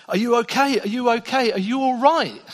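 put-on high, loud worried checking on a child; anxious father. Father going to his crying three-year-old son, anxiously concerned.

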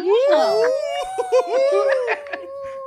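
high, loud sustained squeal of laughter; piercing, drawn-out laughing whoop; shrieking laugh. Real, not acted. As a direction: burst into a long high-pitched laugh. Reaction to the remark.

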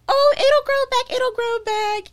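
high, slow, airy mock carefree voice. Imitating her past self to friends, airy and carefree. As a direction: toss it off like nothing happened. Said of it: "And I was like".

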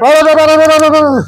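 loud, high babbled la-la chant; mock praying in tongues. Old Spanish lady starting to pray, mock tongues. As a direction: imitate unintelligible fervent praying. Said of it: "And she's still praying".

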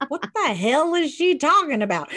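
high, loud shocked outburst; shocked disbelief. Retold or imitated. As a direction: blurt it out in disbelief. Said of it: "And I'm like".

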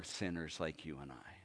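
hushed, whispered tenderly. Real, not acted. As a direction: drop to a soft whisper for the sinners. Tender close of a phrase.

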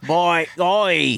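odd put-on rural British accent; mock the actor's strange rural British accent; Pattinson's weird accent. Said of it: "ever-changing accents".

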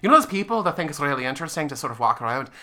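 joking Irish lilt; casual Irish accent, amused. Shown as a demonstration. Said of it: "That's really good".

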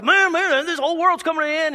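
high, loud panicked man. Retold or imitated. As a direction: cry it out like the world is ending. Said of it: "you're just like".

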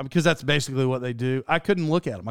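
ordinary delivery

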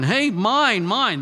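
put-on toddler yelling mine. Three-year-old guarding his toys, loud possessive yell.

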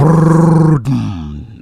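loud, high trilled drum roll; vocal drum roll. Pretend. Co-host introducing number ten, mock drum roll. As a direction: roll the tongue like a snare drum. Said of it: "don't drum roll".

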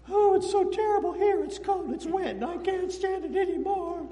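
high whiny complaining voice; hammed-up whine about the cold cell; mock whining. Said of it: "I'd be going".

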